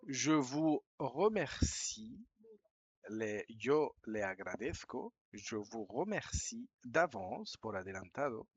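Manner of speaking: slow, deliberate reading of the closing; slow polite reading. Shown as a demonstration. Teacher reading the email's polite closing slowly for learners. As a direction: read the sign-off slowly, clearly.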